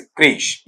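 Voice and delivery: "क्रेश" as one syllable with final sh; one-syllable pronunciation. Shown as a demonstration. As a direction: say "क्रेश" ending on sh, no vowel after it. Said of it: "इसका उच्चारण बेटा इतना रहता है". ("क्रेश" को एक अक्षर में, अंत में श के साथ)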